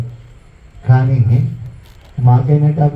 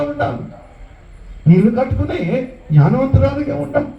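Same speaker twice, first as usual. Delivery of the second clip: high, ringing exhortation to the congregation; fervent preaching; 7 semitones higher than usual. Acted. Preacher urging the women to be wise, fervent high exhortation. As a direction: preach it upward, pleading and urging.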